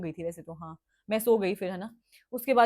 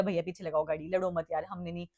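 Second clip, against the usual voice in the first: pleading softly; 4 semitones lower, 6 dB quieter than usual. Retold or imitated. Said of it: "मैंने बोला".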